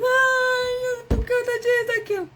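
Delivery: put-on high, whining voice of a crying kid; whiny crying child. Crying child complaining to the parent, high whiny whimper. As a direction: whimper it like a tired, fussy child. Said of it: "O menino tava chorando".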